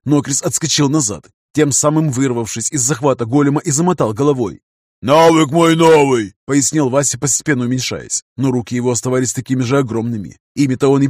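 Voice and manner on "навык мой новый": slow, loud booming roar; booming amplified voice. Acted. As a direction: thunder it out like a giant. Golem Vasya explaining, amplified.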